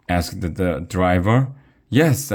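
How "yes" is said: high, loud yes; sudden gleeful yell, pitched up; excited shout. A narrator's reading. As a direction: shout it out eagerly. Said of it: "I shouted".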